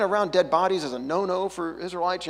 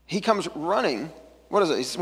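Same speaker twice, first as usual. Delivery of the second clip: normal talking voice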